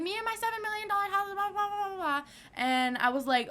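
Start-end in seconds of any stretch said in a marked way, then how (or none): 0.0-2.2 high, fast snooty mimic; mocking the rich woman. Teen mocking the rich mom again, fast high snooty voice. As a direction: rattle it off high and snobby. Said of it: "She was like".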